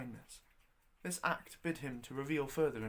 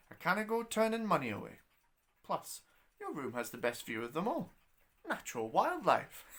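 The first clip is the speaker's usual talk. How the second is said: put-on much higher, louder crafty old woman; proud old landlady; 4 semitones higher, 5 dB louder than usual. Landlady proudly justifying the barn room, high and crafty. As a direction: boast it like a sly old innkeeper.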